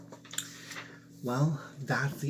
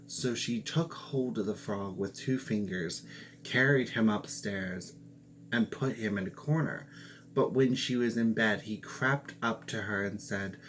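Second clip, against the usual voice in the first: ordinary delivery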